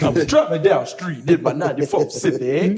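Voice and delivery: loud, high mock rapping; mock rapper. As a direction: ham up a rap lyric. Mocking rap lyric.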